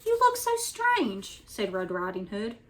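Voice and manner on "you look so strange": put-on high, slow child's voice; puzzled little girl. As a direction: wonder aloud, uneasy.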